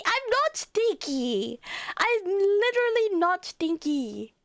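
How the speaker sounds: high, drawn-out mock indignation; playfully offended whine; whiny protest. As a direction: protest up high, stretching the words. Protests being called stinky.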